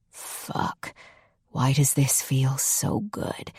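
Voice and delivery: slow hushed whisper. Acted. Ethan whispering in the moment, slow whisper. Lover whispering during sex.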